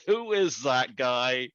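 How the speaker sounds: high, amused retelling through laughter; laughing. Real, not acted. Host telling the actor about that scene, laughing. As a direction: laugh as you get it out. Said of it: "'cause I laughed".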